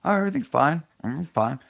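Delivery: bright, high "everything's fine" covering shock; forced reassurance. Retold or imitated. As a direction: pretend all is well, pitch up. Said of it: "And I go".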